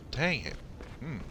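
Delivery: interrupted by a hiccup. Real, not acted. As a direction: hiccups catching the breath. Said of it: "Sorry, I got the hiccups".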